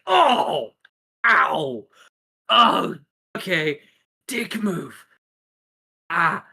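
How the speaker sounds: high yelps of pain; hammed-up hurt exclamations; acted pain. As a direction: groan and yelp after a hard fall. Hero kicked onto his back.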